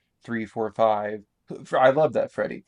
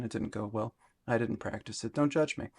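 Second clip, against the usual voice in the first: normal talking voice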